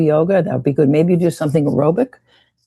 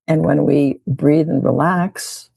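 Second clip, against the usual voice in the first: usual speaking voice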